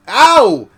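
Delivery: loud high yelp of pain; scream of pain. Acted. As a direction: cry out sharply as if hurt. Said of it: "he screamed".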